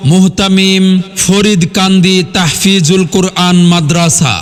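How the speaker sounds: ordinary delivery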